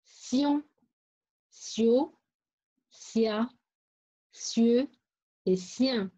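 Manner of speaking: sion, sio, sia, sieux, sien each separate with an s sound; syllable drill. Shown as a demonstration. Teacher introducing syllables to pupils, spaced-out sion, sio, sia, sieux, sien. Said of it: "On découvre les syllabes".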